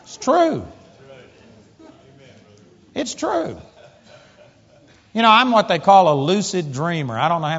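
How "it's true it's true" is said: high squeaky "it's true"; high-pitched insistence. Real, not acted. Insists after audience laughs.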